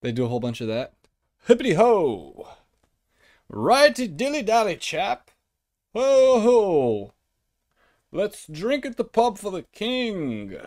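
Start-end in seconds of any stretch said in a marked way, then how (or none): 1.5-10.6 higher, hooting mock British cheer; jolly British monarchist. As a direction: swoop through it like a silly British chap. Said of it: "every British person that, that likes the monarchy".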